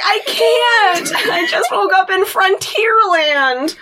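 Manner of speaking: high, drawn-out comic wail; hammed-up freak-out, slowing into a wail; panicked [name]. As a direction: refuse in a mock-hysterical cry. [name] refuses to calm down.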